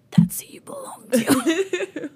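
hammed-up slow raspy whisper; mock raspy whisper. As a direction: rasp it out slowly. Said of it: "with the same raspy voice".

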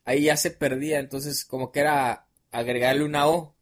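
ordinary talk

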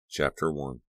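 ordinary delivery, nothing marked

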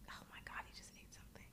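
real whisper, sudden worry; hushed panic as the dog swallows; breathless whispered alarm. Real, not acted. Dog ate something, alarmed.

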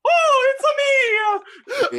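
very high, drawn-out Mario-style Italian cry; hammed-up Italian accent. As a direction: squeal it out in a cartoon Italian accent. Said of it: "now we speak in Italian accent".

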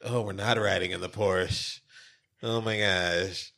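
low, slow, soft letdown; sinking, subdued disappointment; deflated reaction. Retold or imitated. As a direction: drag it out quietly, bluff called. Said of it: "she was all excited".

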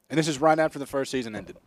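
ordinary talk, nothing marked

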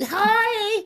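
high sweet neighbor greeting. Pretend.

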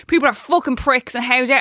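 ordinary delivery

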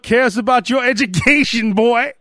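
drawled Southern fatherly line; lightly imitated Southern man; Forrest Gump voice. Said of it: "he's like".

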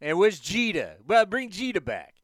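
put-on loud, brash Bronx caller in a New York accent; a hammed-up New York accent; bad New York accent. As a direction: play a Yankees fan calling sports radio in a New York accent. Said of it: "That's my bad New York Yankees, uh, accent".

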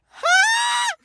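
a piercing high yelp; sharp high shout. Retold or imitated. Boy yelping to show mom the bleeding brother. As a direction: yelp to get mom's attention.

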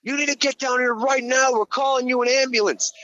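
lightly voiced guard, high and urgent; a hint of an alarmed security guard. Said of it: "He goes".

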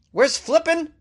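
high, exaggerated question; mock incredulous. Host feigning bafflement at the town with a high question. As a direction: act baffled by the name.

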